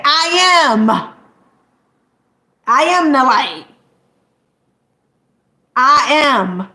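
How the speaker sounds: loud proud proclamation, "I am" rings out; declaiming Jesus. Retold or imitated. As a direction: proclaim it like scripture. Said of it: "what did Jesus say?".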